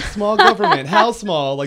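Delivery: high, loud mocking quote; hammed-up political mockery; mock libertarian. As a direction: push the pitch up, loudly ridiculing them. Mocking timid small-government libertarians.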